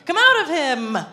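Jesus commanding the spirit out, stern and loud. A narrator's reading. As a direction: order it out with authority. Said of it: "said Jesus sternly".

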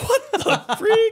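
very high, slow, laughing shock; laughing disbelief. Retold or imitated. Finding [name] at his door, slow high laughing squeal. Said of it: "And I was like".

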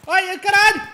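high, loud yelp of shock; startled shout. Real, not acted. Gamer shot out of nowhere, yelping loud. Sudden hit in game.

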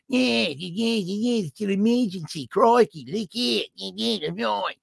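high, garbled mock Aussie babble; bad Australian accent. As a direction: jabber like an incoherent Australian drunk. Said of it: "I can't do the accent".

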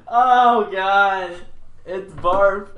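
big high laughter, disgusted; loud laughing. Real, not acted. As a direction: crack up at the taste. Laughing at the barf bean.

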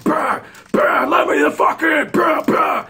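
high, loud yell with banging noises; enraged gunman yelling. Retold or imitated. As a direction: imitate a man pounding and screaming at a door. Gunman pounding, demanding to be let in.

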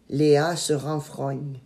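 ordinary delivery, nothing marked

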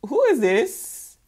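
drawn-out dubious question; slow, judging "who is this"; side-eyeing disdain. Acted. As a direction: look her over suspiciously, slowly. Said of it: "bombastic side-eyeing her like".

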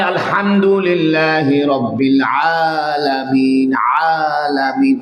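আলামিন drawn out long from the throat; slow, stretched recital of আলামিন; Quranic recitation. Shown as a demonstration. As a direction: press the throat and hold the vowels of আলামিন. Demonstrates Quran recitation sound.

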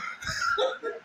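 high crying laugh, nearly a shriek; laughing so hard it brings tears; real helpless howl of laughter; tearful laughter. Real, not acted. Laughing at the clip.

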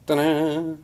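high, loud showman's ta-da; triumphant ta-da. Real, not acted. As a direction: announce it like a magic trick. Presents the block of beeswax.

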